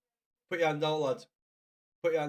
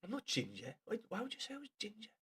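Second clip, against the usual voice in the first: normal talking voice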